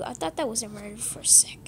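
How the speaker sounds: hushed fast whisper. Real, not acted. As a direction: whisper while hiding. Hiding from the murderer, relieved.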